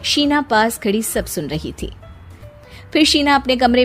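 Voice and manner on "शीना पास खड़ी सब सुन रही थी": ordinary delivery, nothing marked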